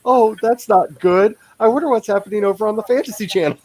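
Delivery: high-pitched pretend nonchalance; mock breezy dismay. Acts out fleeing a dark scene.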